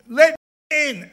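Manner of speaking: high, loud, drawn-out demand; demanding call. Acted. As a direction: call slowly and loudly through the door. Acted demand at the closed door.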